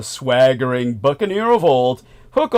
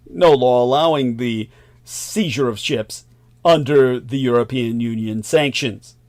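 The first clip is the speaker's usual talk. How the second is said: usual speaking voice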